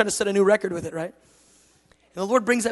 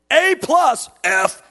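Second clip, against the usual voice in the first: loud, high-pitched grade announcement; mock grading shout; 9 semitones higher, 11 dB louder, much slower than usual. Preacher mocking a pass-or-fail God, loud and high. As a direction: call out the grades at full volume.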